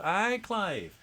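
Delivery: a Scottish "Aye" to the viewer; Scottish accent. Acted. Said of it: "how good your Scottish accent is".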